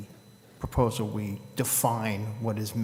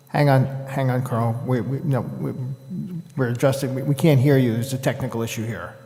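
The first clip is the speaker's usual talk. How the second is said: loud, raised voice over a bad connection; calling out; 8 dB louder than usual. Real, not acted. Chair telling Carl they can't hear him, calling out loudly. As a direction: speak up to halt a remote caller.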